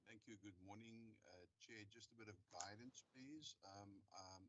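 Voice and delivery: faint voice far from the mic; very soft, distant speech; barely audible. Real, not acted. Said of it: "your voice is very faint".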